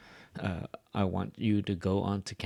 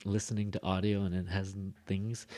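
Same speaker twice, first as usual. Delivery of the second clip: ordinary talk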